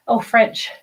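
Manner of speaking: ordinary talk, nothing marked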